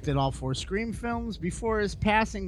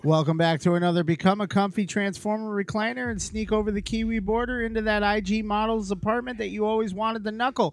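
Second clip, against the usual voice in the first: show announcer; 5 semitones higher than usual. Acted. Host's wacky show opener.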